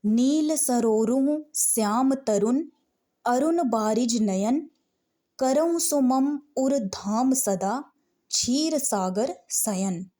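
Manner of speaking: slow, measured devotional reading; slow verse recitation. Shown as a demonstration. Teacher reads the next sortha to students, slow recitation. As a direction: intone it for the class.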